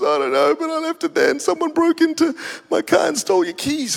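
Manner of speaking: high-pitched wailing imitation; hammed-up weepy confession; mock tearful. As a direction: act out an assistant crying down the phone. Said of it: "She's in tears".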